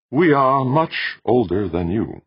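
slow, high, projected statement; calm tenor machine. A narrator's reading. Ancient machine tells visitor its age, stately tenor. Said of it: "said a calm, sweet tenor voice".

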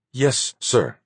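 high, distant crisp reply; Amarjagal's voice. Acted. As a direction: answer like a disciplined officer. Amarjagal agrees, voiced figure.

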